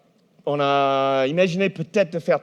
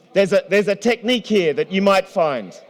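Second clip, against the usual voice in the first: usual speaking voice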